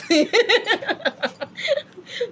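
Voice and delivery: high, delighted burst of giggles; a long run of high laughter; laughing. Real, not acted. Teacher amused by who is learning on the platform, laughing.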